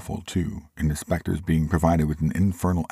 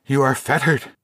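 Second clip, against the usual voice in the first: trembling; 10 semitones higher, 5 dB louder than usual. A narrator's reading.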